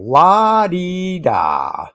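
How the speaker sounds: very slow, drawn-out la-dee-da; mocking sing-song. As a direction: toss it off dismissively. Mock reply to the chat.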